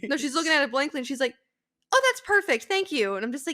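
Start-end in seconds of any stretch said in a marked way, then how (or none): 1.9-3.2 customer voice. Retold or imitated. Customer voiced in retold story.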